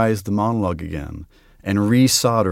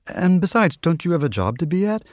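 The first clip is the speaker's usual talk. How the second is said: pitched-up, flustered objection; deflecting protest; 7 semitones higher than usual. A narrator's reading. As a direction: push back with a lifted, lively voice. Lady deflects Death's invitation.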